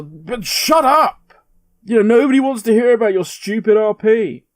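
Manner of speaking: mock angry scream. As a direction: shut them down with an exasperated mock yell. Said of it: "people screaming at them".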